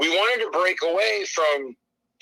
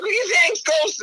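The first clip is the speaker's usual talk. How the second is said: very high, timid thanks; hammed-up sheepish gratitude; meek grateful character; 13 semitones higher than usual. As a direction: thank the boss in a tiny silly voice.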